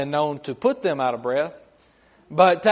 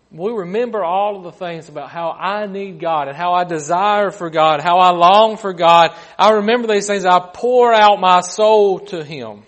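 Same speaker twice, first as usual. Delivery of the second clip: impassioned preaching; 8 dB louder than usual. Acted. Preacher recalls longing for God before the congregation, impassioned preaching. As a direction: build to a loud, stretched proclamation.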